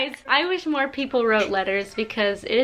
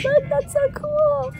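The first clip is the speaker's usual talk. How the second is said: delighted squeal; slower than usual. Real, not acted. Delighted by a gift.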